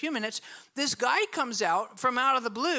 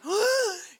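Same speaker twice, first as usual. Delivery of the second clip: mock gasp; 8 semitones higher, 4 dB louder than usual. Shocked at the pushing.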